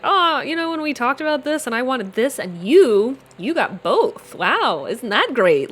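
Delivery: imitating a passive aggressive friend. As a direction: needle her with fake sweetness. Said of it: "a little passive aggressive".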